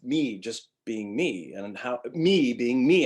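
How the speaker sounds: "me" drawn out slowly and leaned on; deliberate heavy stress on "me". Shown as a demonstration. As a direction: hit each "me" hard and stretch it. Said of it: "I'm stressing the word me".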